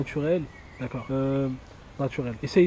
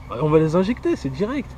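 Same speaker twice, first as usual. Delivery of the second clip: normal talking voice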